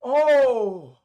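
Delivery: sudden loud high Oh of realization; surprised oh. Real, not acted. Streamer realizing something mid-video, loud surprised oh. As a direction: blurt it out like it just hit you.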